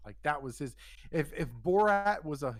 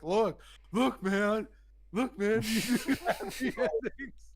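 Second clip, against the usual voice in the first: put-on high, loud pestering drunk buddy; mock insistent; 8 semitones higher, 5 dB louder, slower than usual. Buddy pulls it out for the masseur, mock insistent. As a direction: push it up high, breaking into a laugh.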